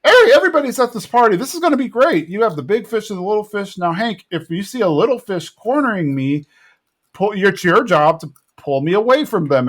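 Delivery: high-pitched party host gushing; hammed-up party excitement; excited Buck. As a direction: start high and quick, then ease into instructions. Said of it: "so Buck's like".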